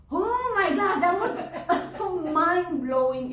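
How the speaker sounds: high, loud gush breaking into laughter; amazed. Real, not acted. Telling a listener about the Japanese crematory, amazed. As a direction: burst out in real awe.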